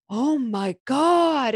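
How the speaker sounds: slow, drawn-out scandalised oh my God; hammed-up disbelief; mock shock. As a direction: play the shocked friend, stretched out. Friends react to taboo story.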